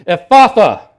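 sudden booming, high shout; powerful command. Acted. Preacher voices Jesus healing the man, powerful shouted command. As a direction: call out the word with full force. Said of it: "his divine and powerful words".

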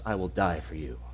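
very soft, low voice; soft promise. Retold or imitated. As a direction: say it gently to the guilty man. Jesus speaks to Barabbas.

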